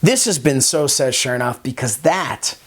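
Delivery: projected announcer read with punched key words; show host sign-off. Acted. Host thanking viewers and plugging other shows, polished announcer sign-off. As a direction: wrap up the show like a TV presenter.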